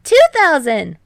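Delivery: loud, high shout; shouted raise. A narrator's reading. Hinata upping the bet to Kei, loud shout.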